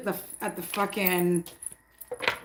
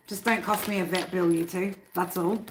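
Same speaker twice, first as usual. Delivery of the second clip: ordinary talk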